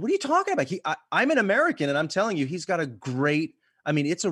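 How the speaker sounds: high-pitched, incredulous comeback; incredulous protest. Retold or imitated. American arguing with Irish actress, incredulous protest. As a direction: re-enact an indignant objection, pitch jumping up at the start. Acted retelling of her argument.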